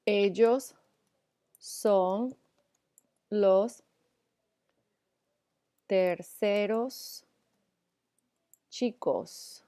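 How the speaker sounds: la primera chica spoken slowly, word by word; slow dictation. Shown as a demonstration. Teacher dictating an example sentence, slow and careful. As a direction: read it out slowly so students can copy it. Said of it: "dictating example sentence slowly".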